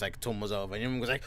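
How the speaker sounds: ordinary talk, nothing marked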